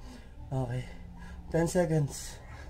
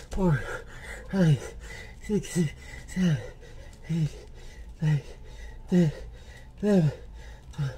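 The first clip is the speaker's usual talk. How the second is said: straining count; 4 semitones higher than usual. Real, not acted. Loud counting while doing push-ups.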